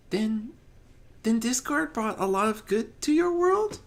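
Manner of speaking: high, hesitant hopeful question; gentle question. Acted. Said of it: "Fluttershy asked gently".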